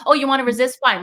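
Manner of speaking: a sharp, sarcastic "fine"; sarcastic retort. Retold or imitated. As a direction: throw it back with mock sarcasm. Mocking the resisting inner child.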